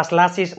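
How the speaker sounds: સ્લાસીસ starting SL in place of KL; misreading with S. Shown as a demonstration. Teacher rejects someone's misreading સ્લાસીસ, with SL. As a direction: misread classes as સ્લાસીસ, S before L. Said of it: "નો થાય".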